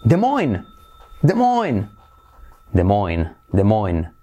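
DES MOINES as "duh-MOYN", both S silent; correct English pronunciation. Shown as a demonstration. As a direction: model DES MOINES slowly repeated, silent S. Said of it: "Y se dice trrr".

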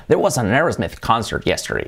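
English AIRS-meth in a sentence; English pronunciation. Shown as a demonstration. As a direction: model English, "Aerosmith" as ERS-meth. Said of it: "hay que decir Aerosmith".

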